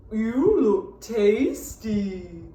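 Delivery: slow, drawn-out cow voice; mooing cow. A narrator's reading. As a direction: stretch each word like a lazy cow. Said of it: "mooed the cow".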